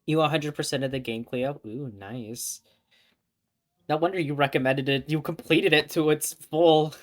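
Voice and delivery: reading the game text aloud as a story; story narration. Acted. Streamer narrating the novel for viewers, storybook tone.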